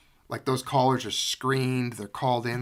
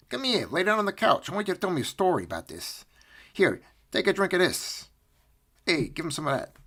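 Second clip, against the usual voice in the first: higher-pitched, hurried coaxing; mock-friendly bossing, pitched up; pretend coaxing; 7 semitones higher than usual. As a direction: play a pushy interrogator luring someone in. Coaxes imagined Dave onto couch.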